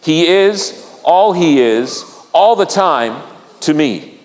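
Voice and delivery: slow, measured phrases for others to join; leading group recitation. Shown as a demonstration. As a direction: lead the congregation through it piece by piece. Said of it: "Can you say it with me".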